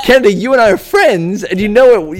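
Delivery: high, loud appeal to a friend; mock protest. Lecture viewer confronts his friend Kennedy, loud protest. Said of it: "And I'm like".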